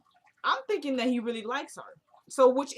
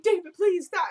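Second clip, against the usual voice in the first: high, loud fake distress; mock pleading cry; 7 semitones higher, 6 dB louder than usual. Woman on the floor faking abuse, crying out high and loud. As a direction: play the staged victim crying out. Staging the fall, fake plea.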